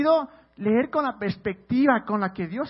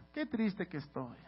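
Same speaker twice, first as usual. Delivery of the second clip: mock sad; 4 semitones lower, 11 dB quieter, slower than usual. Mocking self-pitying Facebook posts.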